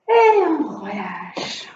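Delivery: slow, low, soothing release; slow calming cue. Real, not acted. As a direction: ease them into letting go. Instructor cues relaxation slowly.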